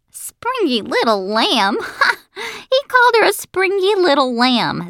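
high, incredulous hoot; amused, with a burst of laughter; laughing in mockery. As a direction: repeat it as if it's hilarious. Junie laughs at the nickname.

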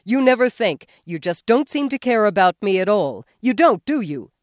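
loud, high, hurt yelling; husband yelling he is uncared for. A narrator's reading. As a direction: pile on the accusations. Husband keeps yelling at wife.

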